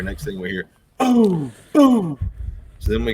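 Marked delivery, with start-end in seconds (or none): 1.0-2.2 high, loud imitated gunshot booms. Friends hear a rival hunter shoot: loud mock boom. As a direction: bark out two gunshots.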